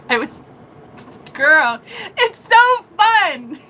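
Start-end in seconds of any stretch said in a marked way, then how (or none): 1.3-3.7 high, loud, drawn-out delight; thrilled and stretched out, pitched way up; gleeful. Real, not acted. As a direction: squeal it slowly with glee. Gleeful aside between laughs.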